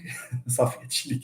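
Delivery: a short quiet laugh under the breath; soft chuckle. Real, not acted. Lecturer chuckles to students about wrong trees, soft laugh. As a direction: chuckle softly at the students' mistake.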